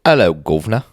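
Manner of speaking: hammed-up British greeting; mock Cockney-style British hello; British accent. Shown as a demonstration. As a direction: put on a cheeky British accent. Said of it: "Can do British".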